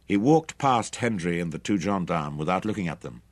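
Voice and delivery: plain reading voice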